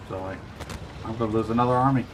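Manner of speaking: normal talking voice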